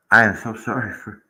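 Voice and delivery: words stretched by a yawn; yawning while talking. Real, not acted. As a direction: talk through a yawn. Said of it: "I do apologize for yawning".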